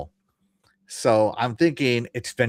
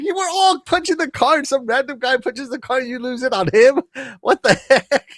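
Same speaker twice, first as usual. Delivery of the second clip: high-pitched, comic disbelief; mock incredulity; 11 semitones higher, 3 dB louder than usual. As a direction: protest in exaggerated amazement, getting louder. Acted incredulous reaction to the scene.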